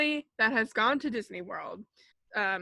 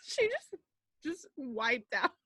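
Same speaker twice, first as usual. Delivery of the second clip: ordinary talk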